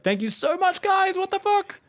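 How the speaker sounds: high, loud burst of thanks; thrilled, caught off guard by the cheer; excited surprise. Real, not acted.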